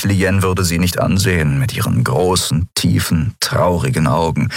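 ordinary delivery, nothing marked

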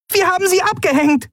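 very high, tiny creature voice cheering; jubilant cheer. A narrator's reading. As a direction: cheer with relief. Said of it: "jubelte Nelen".

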